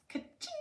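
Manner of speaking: very high squeaked ka-ching; cash register ka-ching. Acted. Cash register sound effect.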